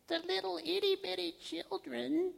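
tiny high, soft voice; soft high-pitched condescension; mocking baby talk. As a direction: coo it as if to toddlers. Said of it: "God just kinda mocks them".

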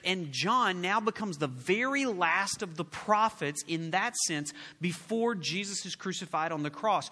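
normal talking voice

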